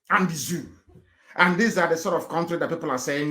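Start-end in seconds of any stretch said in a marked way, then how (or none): none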